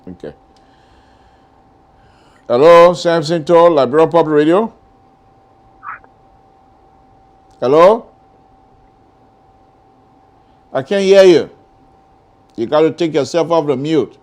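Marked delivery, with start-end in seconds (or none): 2.5-11.5 calling out. Real, not acted. Host calling to silent caller.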